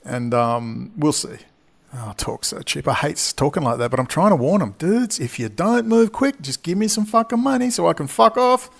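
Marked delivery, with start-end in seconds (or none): none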